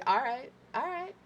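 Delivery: tight-lidded anger climbing in pitch; imitated ragey. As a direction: mimic someone tensely holding rage in. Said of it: "like, your ragey".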